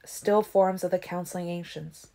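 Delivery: plain reading voice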